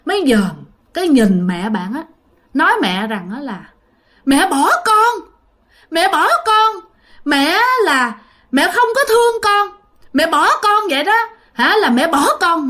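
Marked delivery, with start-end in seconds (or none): 4.2-12.7 high, bitter accusing voice; vengeful ghost child. Retold or imitated. Dead baby's spirit accusing its mother, high bitter wail. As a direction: spit out the grievance like a wronged spirit, pitched high. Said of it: "ánh mắt rất căm thù".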